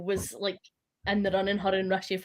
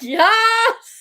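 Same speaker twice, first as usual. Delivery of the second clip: high squealing laugh, much louder; laughing shriek; 13 semitones higher, 9 dB louder than usual. Real, not acted. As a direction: shriek the yes in hysterics. Friends shrieking at funny clip.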